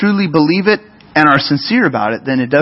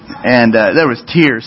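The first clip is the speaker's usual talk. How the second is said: ordinary talk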